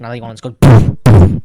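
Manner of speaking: thump out the booms like heavy steps; loud booming mimic. Mimics loud stair noise.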